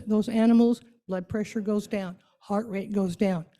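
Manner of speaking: ordinary talk, nothing marked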